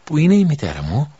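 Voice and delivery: put-on frightened boy calling for his mother; frightened question. A narrator's reading. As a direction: ask for your mother in fear. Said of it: "ρώτησε φοβισμένα".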